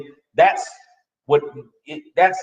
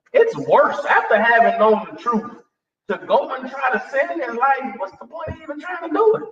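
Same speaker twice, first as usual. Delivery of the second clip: ordinary delivery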